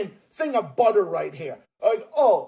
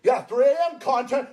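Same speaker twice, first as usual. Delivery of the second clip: ordinary talk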